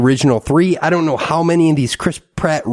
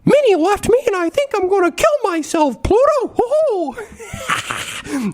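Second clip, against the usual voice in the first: much higher squeaky cartoon mouse falsetto; Mickey Mouse voice; slower than usual. Mickey complaining to Pluto that Minnie left, squeaky falsetto. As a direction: squeak it out like Steamboat-era Mickey. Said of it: "I can do Mickey Mouse".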